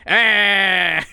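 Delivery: loud, drawn-out wordless mock yell; caricatured yelling noise. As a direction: imitate someone yelling an "aah". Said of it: "he's just yelling at Matt Colville".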